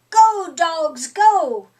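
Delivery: loud command to go; loud call. Acted. Foreman waves the trucks through with a loud call.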